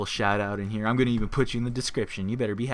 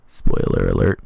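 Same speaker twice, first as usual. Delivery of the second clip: deep, loud booming spoiler warning; announcer warning; 12 dB louder than usual. Acted. Warning viewers before a major spoiler, deep booming announcer. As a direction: drop into a huge trailer voice for the alert.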